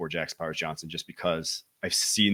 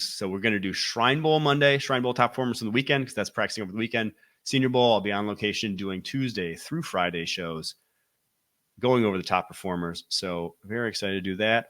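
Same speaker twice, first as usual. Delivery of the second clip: usual speaking voice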